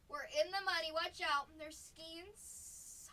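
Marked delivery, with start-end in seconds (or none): 0.1-1.5 much louder triumphant chant; gleeful shout. Real, not acted. Collector crowing to viewers after a big pull, loud and gleeful. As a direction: crow it out like you hit the jackpot.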